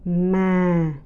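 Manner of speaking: "mà." held long on a low, sinking pitch; low falling tone. Shown as a demonstration. Teacher naming the tones for learners, low falling tone. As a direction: say "mà." drawn out, gently falling. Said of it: "the low falling tones".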